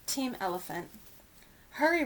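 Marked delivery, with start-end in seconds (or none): 1.8-2.0 short urgent cry to hurry. A narrator's reading. Young elephant urging the others on, urgent cry. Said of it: "Ruby cries".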